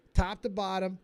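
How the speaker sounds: normal talking voice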